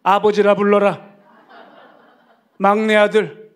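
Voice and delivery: loud, laughing mock protest from the old pastor; sudden outburst. Old pastor rejects being called grandfather, loud and laughing. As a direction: blurt it out loud with a laugh, playfully indignant. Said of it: "그냥 벌컥".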